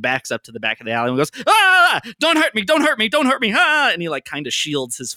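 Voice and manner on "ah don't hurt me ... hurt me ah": high, loud terrified shrieks; panicked screaming. Acted. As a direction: scream in terror, cowering. Mo panics, shields his face.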